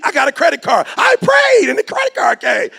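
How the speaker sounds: high, loud mock-pious shout; mock the guy who thinks God sent the card; hammed-up high praise voice. Mocking a person praying for credit.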